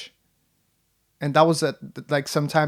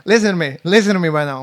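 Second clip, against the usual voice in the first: mock urgent command; 3 semitones higher, 9 dB louder, faster than usual. Mock intense pep talk.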